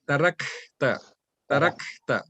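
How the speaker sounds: "Tarakta" with airy K held before "ta"; hams on kaf. Shown as a demonstration. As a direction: release breath after "Tarak", then add "ta". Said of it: "this is how the right pronunciation is".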